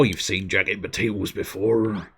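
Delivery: mock-insulting northern English accent. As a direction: say it as an unimpressed northerner. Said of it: "done that in a northern accent".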